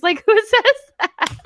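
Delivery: squealing through giggles, pitch way up; cracking up at herself while telling it; shrieking laughter over her own story; laughing high. Real, not acted.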